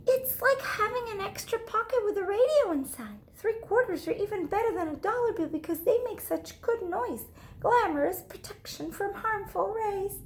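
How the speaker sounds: proud schoolgirl at sharing time; showing-off girl. Acted. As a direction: sell it like a little star. Lily showing off purse.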